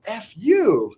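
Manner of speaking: high-pitched retort; retold indignant comeback; blurted disbelief. Blurted reaction to her answer.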